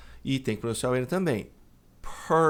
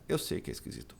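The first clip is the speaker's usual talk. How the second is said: whisper; 9 semitones lower, 8 dB quieter, much faster than usual. Real, not acted. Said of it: "teacher aside, unvoiced".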